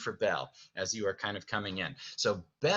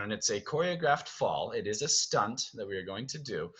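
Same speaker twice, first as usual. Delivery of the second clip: ordinary talk, nothing marked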